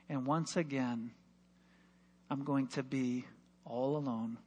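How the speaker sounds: low, soft, fading sadness; sinking disappointment. Real, not acted. Preacher recalling being alone at the race. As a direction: let the hope drain out.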